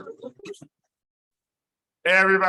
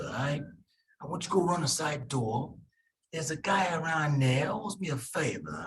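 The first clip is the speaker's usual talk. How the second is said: put-on Italian accent. Lecturer voicing Uncle Vinny to nephew, Italian mobster accent. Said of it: "how the hell I got an Italian accent".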